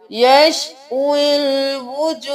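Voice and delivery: यशविल with the wao running straight into lam, very slow; joined recitation. Shown as a demonstration. Teacher demonstrating the right recitation, slowly. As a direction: blend यशविल as one drawn-out word. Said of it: "हम वाव को लाम के साथ मिलाएंगे".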